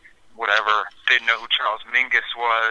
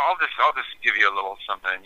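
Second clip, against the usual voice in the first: normal talking voice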